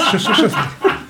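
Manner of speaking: hunchback assistant. Acted. Eager servant answering master.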